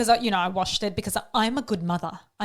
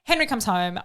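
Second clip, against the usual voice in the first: ordinary talk, nothing marked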